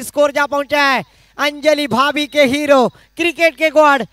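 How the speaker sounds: ordinary delivery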